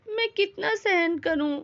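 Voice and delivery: usual speaking voice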